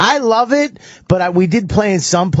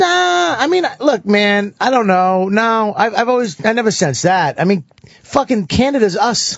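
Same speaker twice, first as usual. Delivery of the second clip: high, insistent brushing-off; emphatic denial; 7 semitones higher than usual. Real, not acted. Comic brushing off animosity toward Americans, high and insistent. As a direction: wave the idea away, pitched up.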